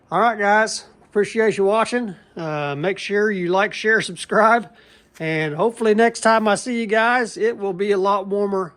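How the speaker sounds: upbeat sign-off. Acted. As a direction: YouTube outro energy. Channel sign-off.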